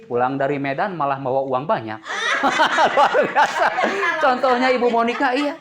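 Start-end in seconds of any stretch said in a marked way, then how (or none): 2.0-5.5 laughing, high and loud with joy; delighted laugh. Real, not acted. Preacher to congregation, laughing loudly with delight. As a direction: burst out laughing at the good news.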